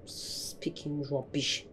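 slow whispered grumble; whispering. Acted. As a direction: mutter it under breath, slowly. Sulking after quarrel.

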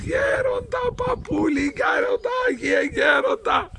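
high calling out across the water; shouting after the boat. Acted. Shepherd running on the sea, shouting to the elder in the boat. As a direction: call the elder back from far away. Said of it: "αρχίζει και φωνάζει".

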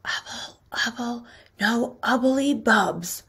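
hushed, very soft magic words; slow, secretive whisper. A narrator's reading. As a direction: whisper it slowly like a secret spell. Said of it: "Then he whispered".

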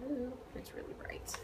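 whispered surprised aside; whispering. Real, not acted. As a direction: breathe it out in a whisper. Aside about camera light.

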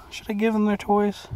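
high playful question to pets; high pet talk. Real, not acted. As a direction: ask the chicks in a lifted voice. Asking the chickens.

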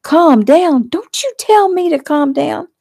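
high, loud, indignant retort; outraged yelling. A narrator's reading. Fiancée is told to calm down, outraged. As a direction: throw his words back at him, shrill with outrage.

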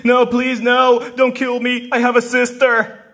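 high, loud plea for mercy; desperate begging. Acted. Begging for life.

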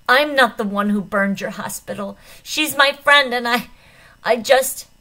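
loud, high, breaking off; desperate shouting. A narrator's reading. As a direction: shout defensively, then falter. Desperate outburst at doctor.

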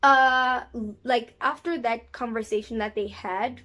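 usual speaking voice

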